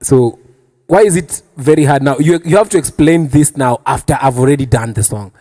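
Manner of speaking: ordinary talk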